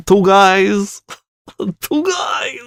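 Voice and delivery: laughing. Real, not acted. Cracking up over jingle.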